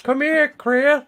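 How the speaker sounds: high, slow creepy coax; high creepy cartoon voice. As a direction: lure him in, high and slow. Doing the creepy voice bit.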